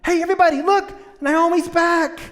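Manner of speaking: high, excited gossip, rising to a loud call; chattering townswomen. Acted. Preacher as the gossiping town women spotting Naomi, high and excited. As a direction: squeal the news to everybody.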